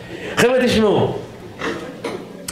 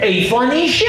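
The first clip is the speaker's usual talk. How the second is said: higher-pitched mock yell, no real volume; retold scream; 6 semitones higher than usual. Passenger to flight attendant, retold mild mock yell. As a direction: imitate an outraged passenger's complaint. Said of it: "הוא צורח על הדיילת".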